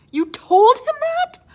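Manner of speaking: very high, loud, slow dismay; dismayed disbelief. Acted. Nico horrified at what Pop told Daggett, high wail.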